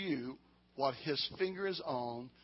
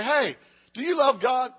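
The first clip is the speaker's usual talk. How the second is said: loud challenge; 7 semitones higher, 9 dB louder than usual. Retold or imitated. Preacher acts out asking someone.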